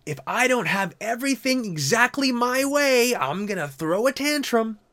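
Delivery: high whiny mock tantrum voice; mock a spoiled driver whining, high; hammed-up whine of a brat.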